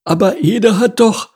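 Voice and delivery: very high bird voice, protesting; protesting ostrich. A narrator's reading. Ostrich defending himself to rhino, high protest. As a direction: start to object, then get cut off.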